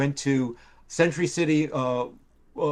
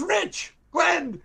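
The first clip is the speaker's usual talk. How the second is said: excited greeting. Retold or imitated. Old friend greeting him, retold.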